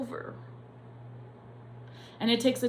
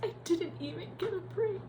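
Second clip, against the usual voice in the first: high, softer, voice cracking with tears; voice breaking; 11 semitones higher, 4 dB quieter than usual. Real, not acted. Nurse repeats her lost break to viewers, voice breaking.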